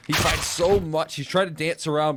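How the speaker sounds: excited. Real, not acted. Caster hypes a multi-kill.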